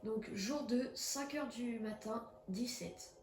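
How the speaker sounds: ordinary delivery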